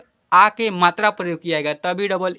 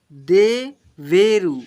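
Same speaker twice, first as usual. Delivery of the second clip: slow reading aloud; 4 dB louder, much slower than usual. Shown as a demonstration. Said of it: "यहाँ पर क्या लिखा हुआ".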